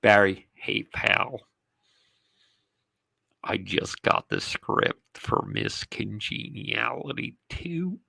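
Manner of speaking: hushed, apologetic phone voice; soft, sorry manager voice. Retold or imitated. Manager breaks bad news softly on the phone, hushed apology. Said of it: "Three days later".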